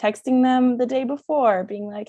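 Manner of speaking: ordinary talk, nothing marked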